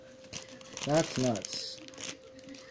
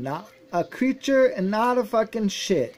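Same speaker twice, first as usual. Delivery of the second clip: angry rant; 7 semitones higher, 3 dB louder than usual. Real, not acted. Angry rant over tampered packs.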